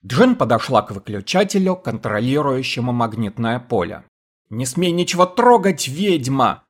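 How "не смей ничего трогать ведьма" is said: high piercing yell; piercing scream. A narrator's reading. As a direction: scream it at her. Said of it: "пронзительно закричал Эрл".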